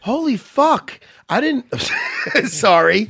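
high, loud burst of real astonishment; pleasantly surprised. Real, not acted. Co-host spotting an unexpected fight on the card, real loud surprise. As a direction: blurt it out, stunned and delighted. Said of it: "I like when I get pleasantly surprised".

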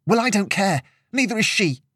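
high, defiant retort; rising temper. A narrator's reading. Angry girl retorting to her friend, high and heated. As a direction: throw it back with temper building. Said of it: "could feel her temper starting to boil over".

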